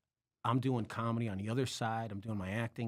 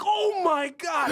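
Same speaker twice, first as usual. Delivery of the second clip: very high, loud, drawn-out cry of panic; panicked outburst; 9 dB louder, much slower than usual. Retold or imitated. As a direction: wail it as the disaster suddenly hits you. Panicked realization in retold story.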